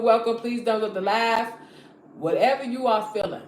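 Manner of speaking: ordinary delivery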